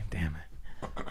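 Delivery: hushed pained whisper; whispered curse. Real, not acted. After pinching himself, a real whispered curse. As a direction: swear under the breath.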